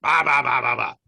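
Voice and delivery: loud high barking bahs; imitating his own barking; mock barking. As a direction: bark nonsense like an angry boss. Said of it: "I'm barking at her".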